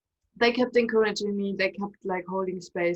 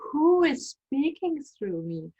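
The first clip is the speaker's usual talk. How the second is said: higher, drawn-out alarmed question; a touch of bewildered fright, pitched up; retold terror; 6 semitones higher, 3 dB louder, slower than usual. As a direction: ask it slowly in retold alarm. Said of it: "I was terrified".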